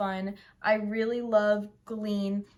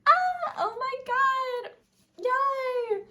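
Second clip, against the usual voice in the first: high, slow delighted squeal; retold squeal of joy; 4 dB louder, much slower than usual. Fan reliving finding the record, squealing. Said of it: "It's like".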